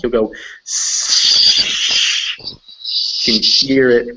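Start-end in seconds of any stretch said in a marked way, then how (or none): 0.8-2.4 long hissed "s" gliding into "sh"; s sliding to sh. Shown as a demonstration. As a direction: drag the tongue back through a held "s" until it becomes "sh". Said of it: "drag your tongue back".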